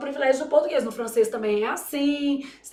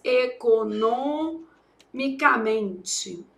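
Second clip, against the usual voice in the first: "economicamente" slowly, NO drawn out and strong; strong pretonic syllable; 2 semitones lower, much slower than usual. Shown as a demonstration. Teacher showing a pretonic syllable, NO strong in "economicamente". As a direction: stretch "economicamente" syllable by syllable, leaning on NO. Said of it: "a gente fala essa forte".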